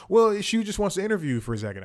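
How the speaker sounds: put-on high, fast, light Italian accent. Host quoting the stranger pitching the interview, fast light Italian accent. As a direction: rattle it off like the pushy stranger. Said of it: "He goes".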